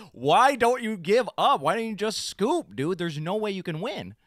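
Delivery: irritated, loud and high taunt; pissed off. Retold or imitated. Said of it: "like, pissed".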